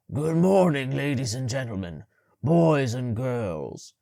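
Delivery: big booming announcement, slow. A narrator's reading. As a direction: boom it to the hall. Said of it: "he boomed in his deep, hoarse voice".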